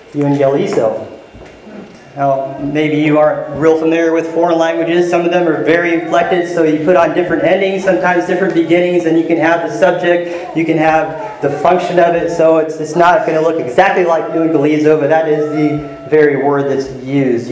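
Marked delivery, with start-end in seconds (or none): none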